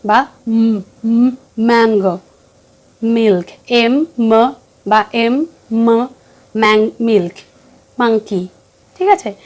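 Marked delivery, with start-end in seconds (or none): 1.0-2.2 উম, ম্যাঙ্গো said as a held hum then the word; phonics sound demo. Shown as a demonstration. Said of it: "এরকমভাবে যখনই বাচ্চাকে পড়াবেন". 3.7-5.4 slow, drawn-out এম and ম; phonics letter sound. Shown as a demonstration. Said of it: "এরকমভাবে যখনই বাচ্চাকে পড়াবেন".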